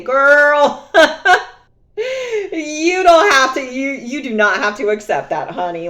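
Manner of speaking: high, loud, laughing-crying comfort; tender to old self. Retold or imitated. Comforting her younger self, half laughing half crying. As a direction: hug your younger self with the words. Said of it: "give the old me a big hug and say".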